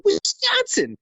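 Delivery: high drawn-out cheer; excited cheer. Retold or imitated. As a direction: shout it like a proud local. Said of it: "and I go".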